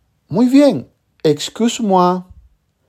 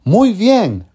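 bright, higher praise; 5 semitones higher than usual. Acted. Teacher praising a student's answer, upbeat. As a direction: cheer the learner on. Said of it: "teacher praising a learner".

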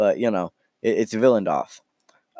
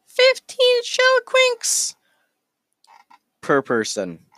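fluctuating voice; slower than usual. Acted. Innkeep quoting a price per person, voice fluctuating up and down. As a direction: leap from normal to squeaky high and back. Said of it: "their voice kind of keeps fluctuating up and down".

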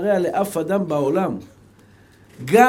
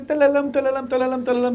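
mock prissy, sing-song babble in a put-on voice; affected prettified talk; 4 semitones higher than usual. Preacher imitating how people talk when showing off, mock affected. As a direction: imitate someone putting on dainty airs. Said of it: "מתייפייף כזה".

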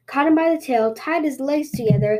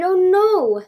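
higher distressed cry; anguished cry; 7 semitones higher, 4 dB louder than usual. Acted. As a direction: cry out again in grief. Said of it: "he cried".

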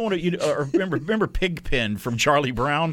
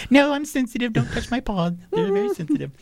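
much higher, fussy pet voice; dog voicing its sensitive paws, much higher; 14 semitones higher than usual. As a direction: play the touchy dog protesting. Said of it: "You're like".

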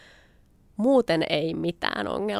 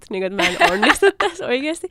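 higher, louder, laughing through the words; laughing disbelief; 7 semitones higher, 10 dB louder than usual. Real, not acted. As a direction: laugh helplessly at your own failure. Both fell down, laughing at failure.

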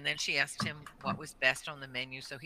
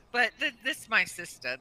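ordinary talk

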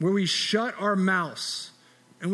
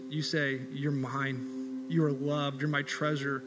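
quiet, reverent prayer voice, lower and softer than the sermon; closing prayer; 3 semitones lower, 5 dB quieter than usual. Real, not acted. Pastor leading the congregation in closing prayer, hushed and reverent. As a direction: pray it gently, unhurried.

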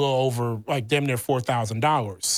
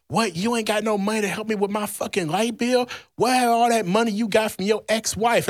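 higher, indignant demand for money; imitating her outraged nagging; mock furious girlfriend, pitched up; angry girlfriend; 6 semitones higher than usual.

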